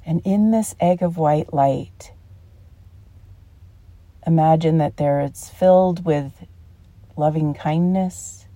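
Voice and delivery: calm meditation guide with long pauses; unhurried, soothing guided-relaxation voice. Real, not acted. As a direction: ease them into relaxation, phrase by phrase.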